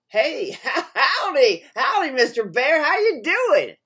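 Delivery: loud, high, hearty hello; eager fox greeting. A narrator's reading. Fox hails the bear as prey to trick, loud and eager. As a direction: greet boisterously, pitching up high.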